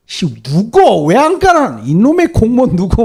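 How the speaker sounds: high, drawn-out griping at the officials; mock grumbling complaint. Preacher imitating Koreans blaming civil servants, hammed-up complaint. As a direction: mimic a petty citizen whining and blaming. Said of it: "막 이렇게 원망불평할 거 아니에요".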